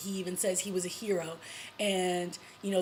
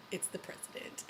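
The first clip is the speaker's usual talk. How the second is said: breathy whispered reveal, much softer; dramatic whisper; 12 dB quieter than usual. Real, not acted. Recapping the show to viewers, a hushed dramatic whisper.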